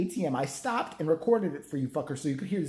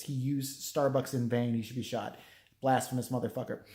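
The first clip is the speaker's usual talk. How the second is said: ordinary delivery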